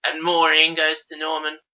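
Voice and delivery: ordinary talk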